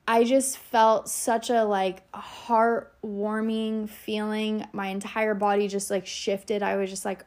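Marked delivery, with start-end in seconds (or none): none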